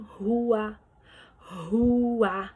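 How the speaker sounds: "rua" with the U and A sounded separately; teacher's demonstration of "rua" as two syllables; hiatus separated. Shown as a demonstration. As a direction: draw out "rua" so each vowel has its own beat. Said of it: "Eu emito som para o U e emito som para o A".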